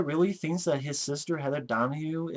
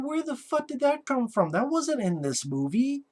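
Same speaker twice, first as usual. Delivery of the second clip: incredulous outburst; 8 semitones higher than usual. Retold or imitated. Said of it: "I'm like".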